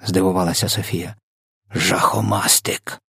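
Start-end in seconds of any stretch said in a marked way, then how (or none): none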